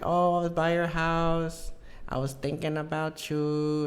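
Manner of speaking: put-on high, slow, drawn-out girlfriend voice; imitated ex. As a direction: mimic the ex, dragging it out. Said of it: "she was like".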